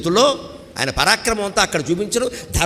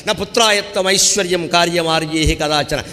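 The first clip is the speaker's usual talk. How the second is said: verse recitation. Shown as a demonstration.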